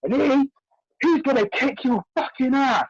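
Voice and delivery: imitating the mum threatening; angry threat. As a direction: hiss the threat shrill and quick. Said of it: "she goes".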